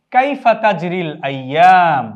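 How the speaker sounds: "কাইফা তাজরিল আইয়াম" said slowly and loudly, each syllable clear; slow Arabic pronunciation. Shown as a demonstration. Teacher shows students the phrase, slow and loud. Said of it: "এখানটায় আমরা উচ্চারণ লিখেছি".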